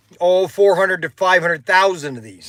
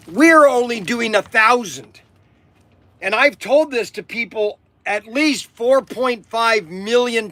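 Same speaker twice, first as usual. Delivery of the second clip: worked-up, raised-pitch sales rant; screaming rant; 4 semitones higher than usual. Real, not acted. Host telling customers about unsold exclusives, worked-up screaming rant. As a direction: push it high and emphatic, nearly yelling. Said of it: "I don't know why I'm screaming".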